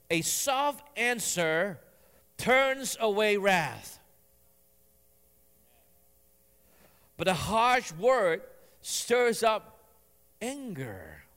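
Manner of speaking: very slow, weighty recitation; slow scripture reading. Shown as a demonstration. Pastor reading Proverbs to the congregation, very slow. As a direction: let each phrase land slowly. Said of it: "verse one says".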